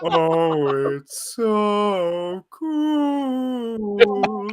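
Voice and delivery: very slow, high, dreamy awe; exaggerated awe. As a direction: stretch it out in mock wonder. Mock awe at meme.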